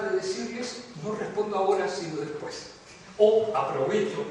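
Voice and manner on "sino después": ordinary talk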